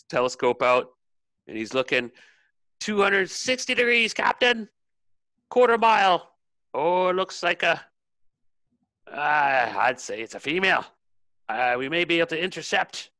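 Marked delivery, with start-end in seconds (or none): 2.8-13.1 high, projected sighting report; raised, strained shout easing as you judge; lookout calling down. Acted. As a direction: call it down to the captain from aloft. Lookout calls sighting down to captain.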